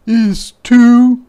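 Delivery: high, loud demand; demanding boy. Acted. Martin loudly demands the camera from Ruthie. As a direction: grab for it with your voice.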